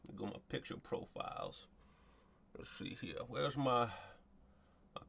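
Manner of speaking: whispered aside to himself; whispered, fast self-talk; whispered muttering. Real, not acted. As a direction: mutter it under your breath.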